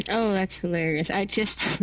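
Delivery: normal talking voice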